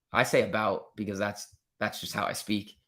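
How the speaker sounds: normal talking voice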